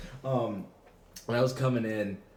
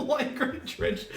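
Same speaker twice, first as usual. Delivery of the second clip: high squeal of laughter; laughing; slower than usual. Real, not acted. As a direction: squeal the words while laughing. Laughing repeat.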